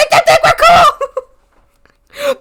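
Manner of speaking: high loud slow laughing yell; laughing shriek. Real, not acted. Streamer telling chat to act cool, laughing shriek. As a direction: squeal it out.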